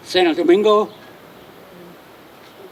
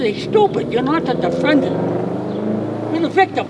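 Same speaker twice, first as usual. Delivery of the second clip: exasperated; 4 semitones higher than usual. Retold or imitated. Exasperated with uncooperative victim.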